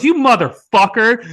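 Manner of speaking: high, loud outraged yell; shocked curse. Retold or imitated. Guest realizing he was fooled, loud high outraged yell. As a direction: yell it as the fake sinks in.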